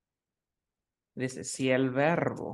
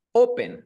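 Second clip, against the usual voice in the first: open, stressed on O-; stress on first syllable; 10 semitones higher, 7 dB louder than usual. Shown as a demonstration. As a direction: open with the weight on "o". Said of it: "¿dónde tiene la fuerza de voz?".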